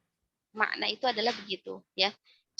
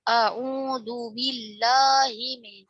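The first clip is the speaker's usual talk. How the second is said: slow recitation with a flat Indonesian "A"; Indonesian-accent Arabic; 3 dB louder, much slower than usual. Shown as a demonstration. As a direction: recite "A'udzu" with a plain Indonesian A. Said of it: "itu dialek Indonesia".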